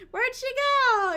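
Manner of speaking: high, loud, drawn-out mock call; acted calling out. Someone looking for her lost in snow, playful calling out. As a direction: call out searching, stretching the last word.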